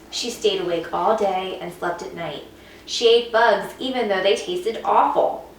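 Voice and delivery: plain reading voice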